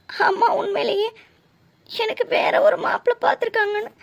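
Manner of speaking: distressed realisation. Acted. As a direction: voice climbing with dismay. Distressed at mother's secret match-making. (கலங்கிய குரலில்)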